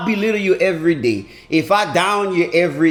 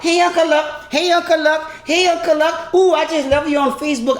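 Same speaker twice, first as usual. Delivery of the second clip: lightly voiced old lady, higher, gushing; old lady fan greeting; 7 semitones higher than usual. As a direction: greet him like an adoring older fan. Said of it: "Everybody wanna talk".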